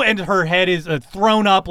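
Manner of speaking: normal talking voice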